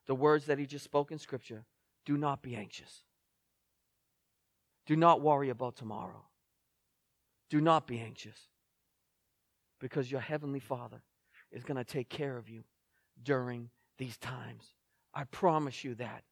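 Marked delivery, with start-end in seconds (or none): 2.0-3.0 soft, tender reassurance; quiet tender voice of Jesus; voicing Jesus. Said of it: "I want you to hear Captain Jesus say". 4.8-16.2 soft tender reassurance, much quieter; quiet comforting voice of Jesus; voicing Jesus. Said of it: "I want you to hear Captain Jesus say".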